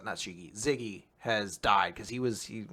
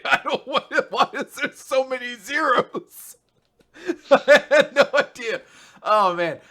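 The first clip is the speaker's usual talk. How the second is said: high, laughing through the words; giggling helplessly while retelling; squeaky laughing mock despair; 12 semitones higher, 6 dB louder than usual. Real, not acted. Laughing through binary quiz retelling.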